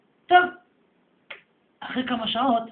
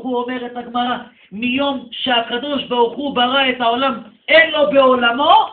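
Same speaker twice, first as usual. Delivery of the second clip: louder preaching, rising at the key phrases; 4 semitones higher, 13 dB louder than usual. Real, not acted. Rabbi preaches to his congregation with rising fervor.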